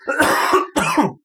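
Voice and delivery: a cough from building dust; hacking cough before excusing oneself. Acted. Player coughing as the man a building fell on, building cough. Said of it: "that was a building cough".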